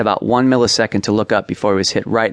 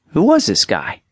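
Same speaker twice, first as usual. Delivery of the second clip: high-pitched incredulous question; astonished, pitch leaping up then falling; acted amazement; 7 semitones higher than usual. A narrator's reading. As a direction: marvel at the new kid. Amazement after the big play.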